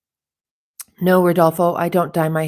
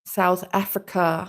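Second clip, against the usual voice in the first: ordinary talk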